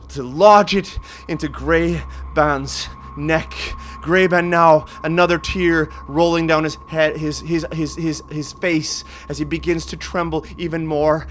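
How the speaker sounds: loud, high, gripping narration; intense climax. A narrator's reading. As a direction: build to a frantic peak.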